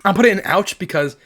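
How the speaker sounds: ordinary talk, nothing marked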